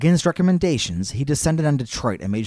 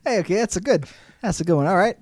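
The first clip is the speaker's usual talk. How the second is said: higher, friendly mock Canadian; Canadian accent; 6 semitones higher, faster than usual. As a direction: encourage them in a Canadian accent. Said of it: "That's what Canadians sound like".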